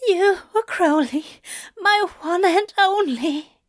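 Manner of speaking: high, fervent declaration; moaning. A narrator's reading. Aziraphale declaring love to Crowley, moaning. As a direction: moan it high, with devotion. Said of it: "Aziraphale moans".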